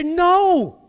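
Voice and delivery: a sudden high shouted No; loud protest. Acted. Peter crying out his refusal to God. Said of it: "He would protest this".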